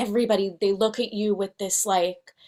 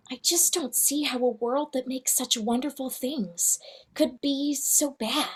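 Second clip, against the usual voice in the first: soft, wistful princess voice; dreamy mermaid princess, softly; Ariel voice; 4 semitones higher, 8 dB quieter than usual. Pretend. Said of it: "here's a better one".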